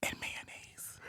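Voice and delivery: deadpan whisper; ASMR whisper. Pretend. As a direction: whisper it with a straight face. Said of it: "Give us a little taste".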